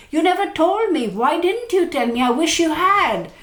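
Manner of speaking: reproach, wounded and pleading; hurt partner. Retold or imitated. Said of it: "that sort of a conversation will happen".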